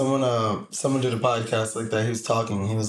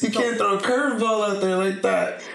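higher imitation of a laughing objection; amused, pitched-up mock complaint; mock protest; 7 semitones higher than usual. Retells laughing host's protest.

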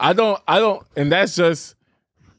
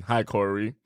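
mock cold greeting; 6 semitones lower, 8 dB quieter than usual. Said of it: "one day I tried to be so mad".

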